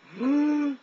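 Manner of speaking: loud, high-pitched gasp; sharp gasp. Real, not acted. After freaking himself out, loud gasp. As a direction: suck in a sudden startled breath.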